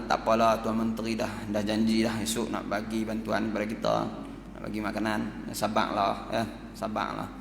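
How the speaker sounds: put-on soft, gentle comfort to hungry children; soothing mother. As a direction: hush the children tenderly. Ibu memujuk anak-anak lapar. (ibu memujuk anak-anak yang lapar dengan suara lembut)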